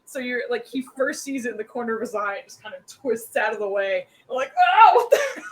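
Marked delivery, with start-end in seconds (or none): 4.8-5.4 high, loud mock shock breaking into laughter; startled outcry. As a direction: yelp as the startled foe, laughing. Voices enemy startled by near miss.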